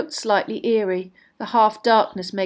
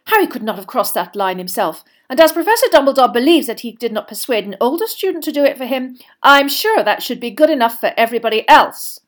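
loud, indignant tirade; raised voice, insistent and exasperated; angry professor; 5 semitones higher, 10 dB louder than usual. A narrator's reading. Professor defending Harry before the others, angrily. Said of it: "She shot a very angry look".

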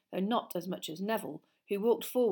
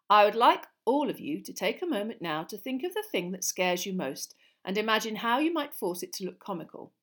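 lively, louder classroom lecture; lightly voiced teacher; 2 semitones higher, 7 dB louder than usual. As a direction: project like an encouraging teacher.

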